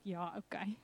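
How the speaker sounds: soft, resigned ja okay; meek agreement. Retold or imitated. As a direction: give in quietly, barely voiced. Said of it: "jy sê net".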